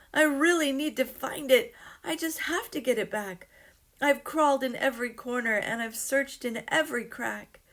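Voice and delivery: put-on high, loud, rushed pleading old man; distressed old man. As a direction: beg desperately to get it back. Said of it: "said the old man with a frown".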